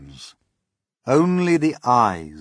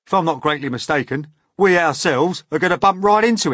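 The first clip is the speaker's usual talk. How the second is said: sharp; 7 semitones higher, 9 dB louder than usual. Acted. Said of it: "the centipede said sharply".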